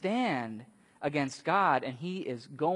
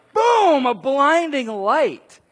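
dramatic booming shout; 8 semitones higher, 12 dB louder, slower than usual. Retold or imitated. Preacher booming out the blinding light. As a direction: boom it out loud and high, then slow.

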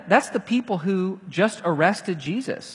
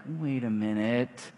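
suspicious; 6 semitones lower, 8 dB quieter than usual. Retold or imitated. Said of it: "she's like".

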